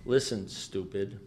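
low, soft and very slow; deadpan put-down. Retold or imitated. Sponsor to upset alcoholic, deadpan put-down. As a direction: deliver the insult slowly, quietly unruffled.

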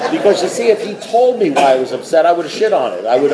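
normal talking voice

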